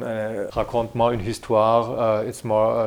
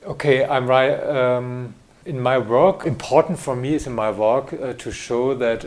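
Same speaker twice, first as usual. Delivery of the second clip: ordinary talk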